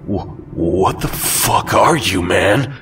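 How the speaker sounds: slow, shaky, stammering fear. Acted. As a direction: stammer in terror. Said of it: "in a shaky voice".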